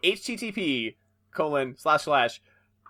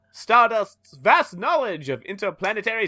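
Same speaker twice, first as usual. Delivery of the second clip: dramatic narration; 7 semitones higher, 5 dB louder than usual. Acted. Narrator starts reading the comic.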